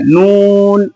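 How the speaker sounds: नून held long, with the nasal sound only at the start; teacher models नून, drawn out, not through the nose; noon not nasal. Shown as a demonstration. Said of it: "नाक में नहीं लेके जाना है".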